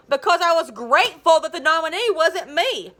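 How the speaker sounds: heated. Acted. Hugh's heated reply.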